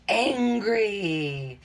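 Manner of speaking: loud growled angry; mock angry bark. Shown as a demonstration. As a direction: say it like a cross child. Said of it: "Angry is how I feel".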